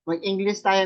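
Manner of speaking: ordinary talk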